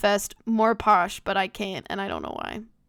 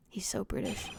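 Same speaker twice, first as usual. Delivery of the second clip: whispered aside; 3 semitones lower, 13 dB quieter than usual. Real, not acted.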